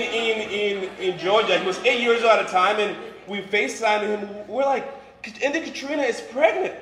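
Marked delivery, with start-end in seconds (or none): none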